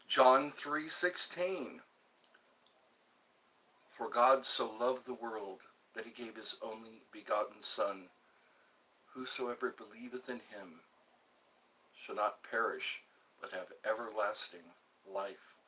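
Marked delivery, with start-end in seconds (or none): 3.9-15.5 low, soft, measured verse reading; scripture recitation. Real, not acted. Streamer reciting John three sixteen, low hushed recitation. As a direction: recite it quietly, pausing between phrases.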